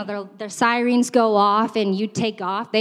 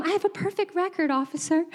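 high, soft and sweet to the officer; sweetly innocent, softly raised; 6 semitones higher, 7 dB quieter than usual. Retold or imitated. Telling the officer, high soft innocent voice. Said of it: "So I always tell them".

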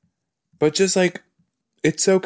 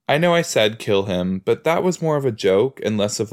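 ordinary delivery

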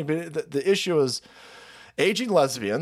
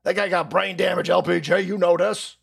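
mock bystander; 2 semitones higher, slower than usual. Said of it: "everyone's like".

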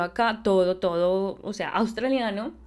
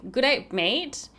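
slow drawn-out Aussie greeting; light Australian accent; 3 semitones higher, much slower than usual. Shown as a demonstration. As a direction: say g'day like a local Aussie. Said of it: "acá en Australia suena un poco más".

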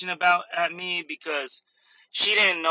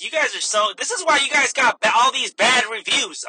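loud, raised customer complaint; mock indignant; 5 semitones higher, 7 dB louder than usual. Customer scolds cashier over bad reviews, mock indignant. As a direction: imitate a customer ranting at staff.

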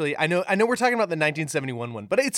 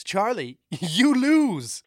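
Wonka's harsh verdict; 7 semitones higher, 6 dB louder, much slower than usual. Retold or imitated. Quoting Wonka's famous line.

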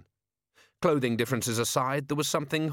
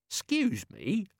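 timid politeness; 9 semitones higher, slower than usual. Acted. Nobby polite.

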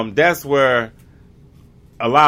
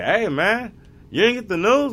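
messenger on horseback; 10 semitones higher than usual. Retold or imitated. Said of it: "and say".

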